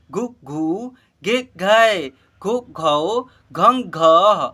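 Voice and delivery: slow, loud, drawn-out syllable recitation; syllable drill. Shown as a demonstration. Teacher leads class in reciting barahkhadi, slow and loud. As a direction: call out each syllable clearly for the class to repeat. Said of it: "देखिए मेरे साथ साथ उच्चारण करना है".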